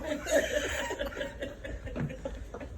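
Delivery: soft, very high wheezing laugh; squeaky trailing laughter; helpless giggling. Real, not acted. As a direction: laugh yourself out of breath. Laughing at the retold exit.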